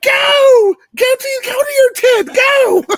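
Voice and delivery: high, loud shouted order; mock yell. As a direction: shout him off to his tent. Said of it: "And I'm like".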